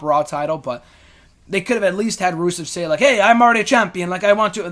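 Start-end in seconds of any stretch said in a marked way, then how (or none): 3.0-4.7 mock Italian accent, higher and louder; hammed-up Italian wrestler voice, pitched up; Santino-style Italian accent. Podcaster voicing a wrestler's title complaint, mock Italian accent, louder. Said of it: "that's a Santino voice".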